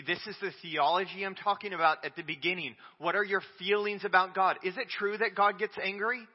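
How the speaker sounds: normal talking voice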